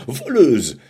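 high, loud accusing cry; girl snatching a pen, crying out high and loud; shouting. A narrator's reading. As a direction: yell it out, grabbing the pen. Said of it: "en criant".

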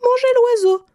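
very high, feigned innocence; mock-innocent cat. A narrator's reading. Cat denying it would eat the bird, very high and innocent. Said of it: "Le chat prit un air innocent".

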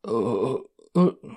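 short acted moans of pain; sick man's groan. A narrator's reading. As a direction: moan in little broken ohs. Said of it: "gémit le malade". (gémissement de malade)

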